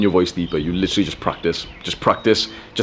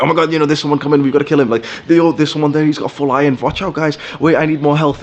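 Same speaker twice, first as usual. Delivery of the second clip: high, loud, fast panicked callouts; excited gamer callouts; 7 semitones higher, 3 dB louder, faster than usual. Retold or imitated. Teen gamer warning teammates on Skype, excited high yelling. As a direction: yell warnings to your team mid-fight.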